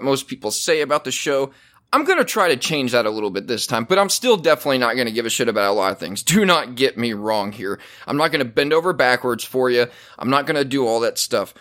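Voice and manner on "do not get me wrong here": usual speaking voice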